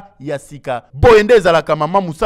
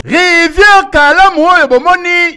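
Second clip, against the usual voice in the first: excited; 14 semitones higher, 6 dB louder, slower than usual. Real, not acted. Reporter opening segment.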